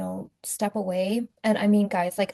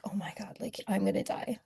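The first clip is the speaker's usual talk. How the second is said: breathless hushed whisper, much softer; terrified whisper; 8 dB quieter than usual. Retold or imitated. Retelling her panic as the abuser rushes in, whispered. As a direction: whisper it like death is coming through the door.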